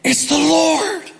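high, slow awed call; sudden recognition. Retold or imitated. John calling to Peter from the boat, high awed call. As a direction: call out the realization slowly. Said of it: "John looks up and goes".

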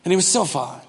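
normal talking voice

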